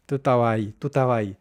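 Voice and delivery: estabas said as taba, S and B lost; tú estabas ahí run together as tu taba i; Chilean estabas. Shown as a demonstration. Teacher modelling the dropped S and B in estabas. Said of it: "vamos a representarlo así".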